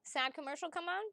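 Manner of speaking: ordinary talk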